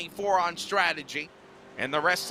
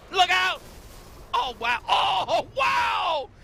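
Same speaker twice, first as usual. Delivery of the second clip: excited; 9 semitones higher, slower than usual. Real, not acted. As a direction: gasp at the crash. Reacting to wreck replay.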